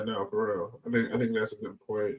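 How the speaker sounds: normal talking voice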